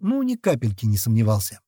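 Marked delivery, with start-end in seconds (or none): none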